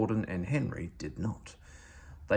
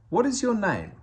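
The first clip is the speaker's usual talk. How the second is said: usual speaking voice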